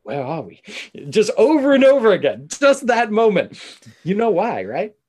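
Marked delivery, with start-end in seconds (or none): 0.0-0.6 put-on lower, lightly accented actor's line; a hint of British accent, voice dropped; Monaghan impression. Mimicking the line replayed in every TV commercial, lightly accented impression. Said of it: "doing a little Monaghan".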